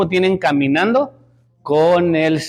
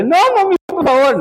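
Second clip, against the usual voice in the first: imitating a weeping child begging mommy; crying child pleading; 12 semitones higher than usual. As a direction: beg like a tearful small daughter. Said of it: "estaba llore y llore".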